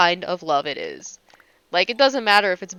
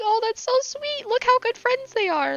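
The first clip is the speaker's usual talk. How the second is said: gushing coo. Retold or imitated. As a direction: coo up high like watching puppies. Cooing over best friends on screen.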